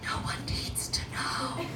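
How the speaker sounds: hushed secret whisper. Acted. Whispering in the bathroom stall so the other women can't hear. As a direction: breathe it so nobody hears. Secretly opening tampon packaging.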